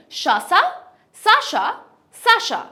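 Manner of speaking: high, punched সাশা, "sa-sha", switching s and sh; crisp contrast of s and sh in সাশা; s-sh drill. Shown as a demonstration. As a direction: drill শাসা and সাশা as separate high bursts. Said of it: "স এবং শ এর মধ্যে".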